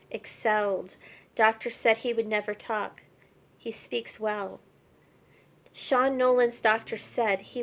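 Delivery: emphatic, measured poem reading; poem recitation. Acted. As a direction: recite in short, punched phrases with pauses. Reads her found poem aloud.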